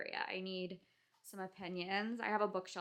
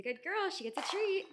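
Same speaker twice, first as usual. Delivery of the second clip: squeaky praising a dog; 11 semitones higher, 8 dB louder than usual. Real, not acted.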